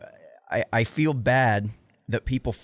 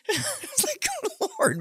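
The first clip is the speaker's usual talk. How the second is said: laughing disbelief; 4 dB quieter, much slower than usual. Real, not acted.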